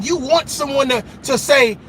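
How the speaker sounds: ordinary delivery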